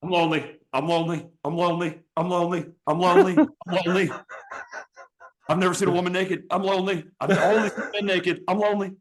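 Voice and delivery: high pitiful moaning repetition; hammed-up lonely wail; lonely croc plow voice. Shown as a demonstration. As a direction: moan it like a sad lonely thing. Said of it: "or you just go".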